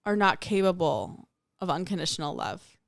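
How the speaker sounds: normal talking voice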